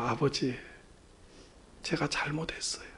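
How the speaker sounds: soft, half-whispered remorse; hushed apology. Retold or imitated. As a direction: apologise softly to your father. Son begs father's forgiveness. (나직이 용서를 구하듯)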